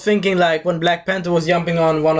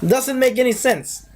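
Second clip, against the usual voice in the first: high-pitched mock indignation; exasperated, higher-pitched challenge; incredulous questioning; 7 semitones higher than usual. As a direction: call out the plot hole in a raised voice. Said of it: "And I'm like".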